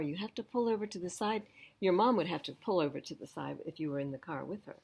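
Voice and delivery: ordinary delivery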